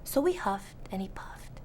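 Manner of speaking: ordinary delivery, nothing marked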